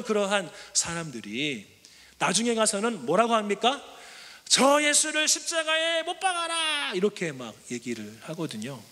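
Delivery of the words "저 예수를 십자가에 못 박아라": higher, harsh crowd cry; crowd's demand. Retold or imitated. Preacher voices the crowd demanding crucifixion, harsh. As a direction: cry it out like the hostile mob.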